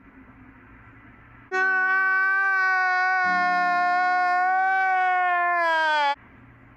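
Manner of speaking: long loud high fake wail; high sustained comic howl of despair; mock wail. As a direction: let out a hammed-up crying wail. Long cry-laugh wail.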